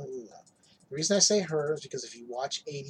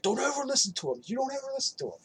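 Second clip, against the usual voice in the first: freaking out; 9 semitones higher, faster than usual. Retold or imitated. Bill grabbing the kid, begging his family flee, frantic. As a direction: plead urgently in a high mock panic. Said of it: "that's when Bill starts freaking out".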